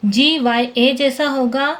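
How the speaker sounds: ordinary talk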